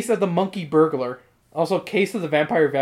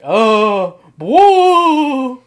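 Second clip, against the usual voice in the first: loud, high "whoa"; overacted delight at the sight; surprised exclamation; 7 semitones higher, 10 dB louder than usual. Imitating the dog's surprise.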